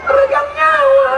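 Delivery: very high, squeaky effeminate falsetto; sassy drag queen. Pretend. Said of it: "kita pakai suara perempuan". (suara bencong melengking)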